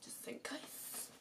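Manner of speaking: soft breathy whisper. Real, not acted. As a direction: whisper it under your breath. Whispered aside after sneeze.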